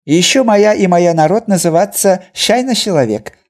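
high, loud broken-Russian trader; Chinese merchant voice. A narrator's reading. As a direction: chatter it out eagerly. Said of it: "продолжал тот".

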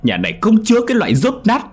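very high, full-force shouting; furious cursing. A narrator's reading. Father throwing his daughter out, screaming abuse. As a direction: roar them out of the house. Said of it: "Ông Đô mắng chửi cho hả hê".

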